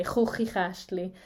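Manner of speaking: "Hochigastli" with strong throat-scraped ch sounds; drawn-out "Hochigastli", every ch rasped in the throat. Shown as a demonstration. Teacher showing a Swiss German word, strong ch. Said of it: "very strong ch sound".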